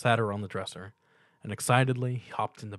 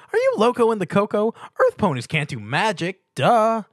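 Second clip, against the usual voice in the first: very high, loud bubbly pony voice; Pinkie Pie; 11 semitones higher, 10 dB louder than usual. A narrator's reading. Pinkie Pie greeting Stuart with a loud high squeal. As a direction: squeal the welcome like an overexcited pony.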